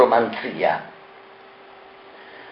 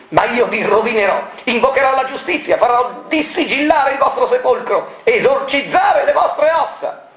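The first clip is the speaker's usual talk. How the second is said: much higher, shrill accusation; accusing; 13 semitones higher than usual. Acted. Doctor Negri, possessed, denounces Variconi. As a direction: spit out the accusation. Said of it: "proseguì la dottoressa Negri".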